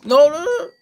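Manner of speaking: very high, loud held cry; shriek. Real, not acted. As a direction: shriek in panic. Shrieking at enemy.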